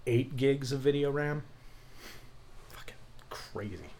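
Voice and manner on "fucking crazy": very soft whisper; whispered amazement. Real, not acted. Host marvelling at video card specs, whispered amazement. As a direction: whisper it under your breath, amazed.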